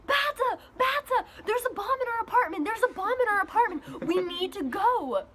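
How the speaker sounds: high, frantic imitation of a scared kid; panicked. Brother calling his sister about a bomb, high and frantic. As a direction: play the little brother in a panic.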